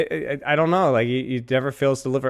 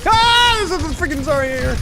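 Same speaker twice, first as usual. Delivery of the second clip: startled yell; 12 semitones higher, 4 dB louder than usual. Real, not acted. Surprised by enemy in game.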